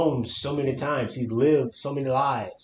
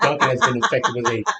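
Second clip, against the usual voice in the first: louder, laughing through words; 3 semitones higher, 5 dB louder than usual. Real, not acted. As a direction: chuckle while saying it. Laughing at his own joke.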